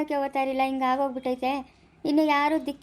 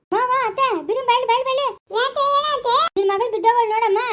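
frantic, much higher and louder cries for help; panicked shouting; 8 semitones higher, 6 dB louder than usual. Acted. Calling the family because the girl has collapsed, frantic shout.

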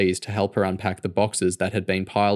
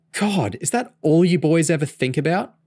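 higher, mock-exasperated scold; 8 semitones higher than usual. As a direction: tease him with a playful scolding. Girl scolds boyfriend.

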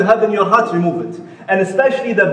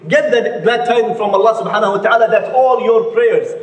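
usual speaking voice

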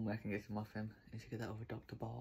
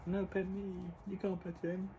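dog's voice; 9 semitones higher than usual. Pretend. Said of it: "he's like".

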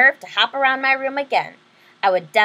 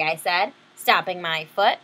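usual speaking voice

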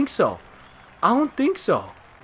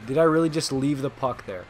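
ordinary talk, nothing marked

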